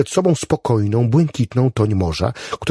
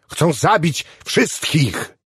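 high, slow, heated protest; exasperated insistence; 6 semitones higher, much slower than usual. A narrator's reading. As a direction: hammer it home slowly, pitched up. Acted quarrel, Clevenger insisting.

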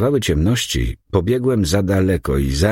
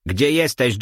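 calling out higher across the room; loud call; 5 semitones higher than usual. A narrator's reading. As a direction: shout it out to find the chimp. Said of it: "głośno zawołał". (głośne wołanie)

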